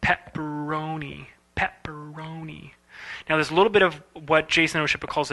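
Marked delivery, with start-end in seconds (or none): 0.0-2.7 slow pepperoni with only two jaw opens; smooth pepperoni. Shown as a demonstration. Showing the student the right way, slow and soft. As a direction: pepperoni spoken slowly and softly, fading. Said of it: "as that animator thought".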